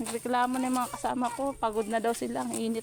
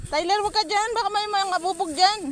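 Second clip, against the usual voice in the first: calling out; 7 semitones higher, 6 dB louder than usual. Real, not acted. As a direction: call out a sharp warning. Warning child about broken glass. (pasigaw na babala)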